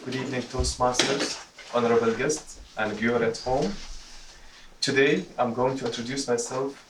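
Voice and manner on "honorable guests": ordinary talk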